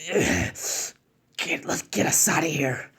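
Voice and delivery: whispered escape; urgent whisper; hushed urgency. Acted. Fleeing ninja, hushed retreat.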